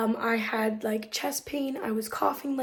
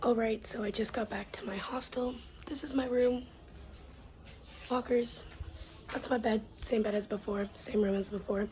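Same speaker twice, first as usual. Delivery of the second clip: hushed whisper in a shared room; 9 dB quieter than usual. Real, not acted. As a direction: whisper so roommates sleep on.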